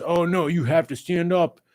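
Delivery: a hint of a whiny mocking voice; mocking commenter. Parodying a stand-up-only fan, lightly mocking. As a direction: sneer like a know-it-all fan. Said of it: "Not this".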